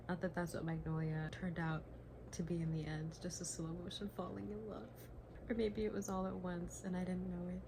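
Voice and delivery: soft, intimate reading of the passage; reading aloud. Acted. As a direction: read it gently to yourself. Reads the book passage aloud.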